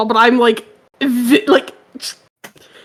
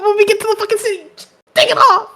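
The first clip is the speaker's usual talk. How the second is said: exasperated yelling; 8 semitones higher, faster than usual. Acted. Demanding armor removal, exasperated.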